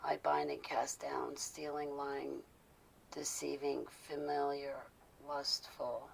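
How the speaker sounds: hushed, intense prayer binding spirits; whispered prayer. Acted.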